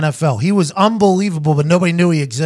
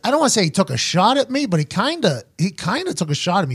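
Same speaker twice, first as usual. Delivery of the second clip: usual speaking voice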